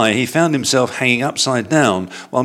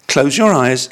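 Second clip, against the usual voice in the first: put-on young bear voice; 5 semitones higher, 4 dB louder than usual. Paddington's voice in story.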